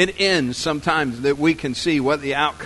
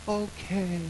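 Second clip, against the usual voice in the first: soft, higher, dreamily sweet okay; dreamy agreement; 5 semitones higher, 6 dB quieter than usual. Acted. Joseph answering the angel in his dream, soft and dreamy. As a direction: answer meekly as if half asleep. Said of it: "And in his dream, he goes".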